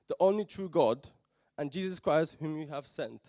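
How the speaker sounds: ordinary delivery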